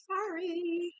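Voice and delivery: high, drawn-out sorry; singsong apology. Real, not acted. Reader back from a pause, singsong apology.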